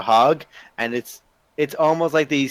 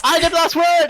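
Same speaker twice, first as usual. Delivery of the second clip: high, loud triumphant shout; gleeful yell; 5 dB louder than usual. Acted. Sibling shouting in triumph while running off. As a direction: crow about winning. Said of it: "And he runs off".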